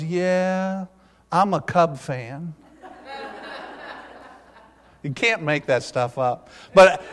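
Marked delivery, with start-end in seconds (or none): 0.0-2.5 lightly voiced kid, slow and softer; slow, soft imitation of a camper; ten-year-old camper. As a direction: draw it out like a sympathetic boy. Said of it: "now this is how he talked".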